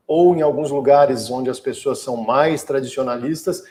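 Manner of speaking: ordinary delivery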